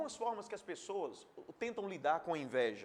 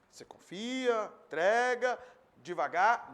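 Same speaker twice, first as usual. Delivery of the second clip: preaching emphasis; 2 semitones higher, 8 dB louder, slower than usual. Acted.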